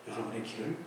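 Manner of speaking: ordinary talk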